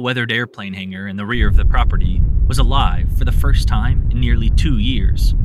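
usual speaking voice

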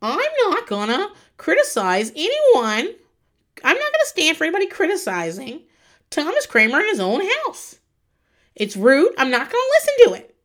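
high, indignant huffy voice; imitating Leah defending the host; mock Leah. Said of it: "with her drink and goes".

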